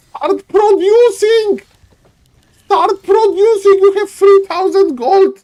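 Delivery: high, loud pleading yell; exasperated shout. Acted. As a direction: scream at him to spend the gold. Caster begging player to spend.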